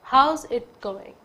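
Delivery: slow, drawn-out "how's it going"; slow demo. Shown as a demonstration. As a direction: linger on "how's" and let it fall. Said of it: "इसकी आवाज़ आ रही है". (धीमे से "how's it going?")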